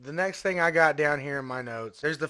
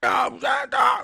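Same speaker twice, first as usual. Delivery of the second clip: high, slow, shouted shriek; hammed-up shrieking brat; mock shriek; 8 dB louder, slower than usual. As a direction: screech it like a bratty kid. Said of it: "screams that he says".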